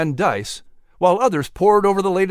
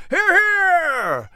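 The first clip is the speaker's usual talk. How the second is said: much higher tavern cheer; rowdy cheer. Acted. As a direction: gentlemen applaud their leader.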